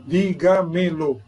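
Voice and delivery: "dígamelo" slowly, di-ga-me-lo, each syllable apart. Shown as a demonstration. Teacher showing students the parts of "dígamelo", syllable by syllable. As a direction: spell out "dígamelo" in slow, separate syllables.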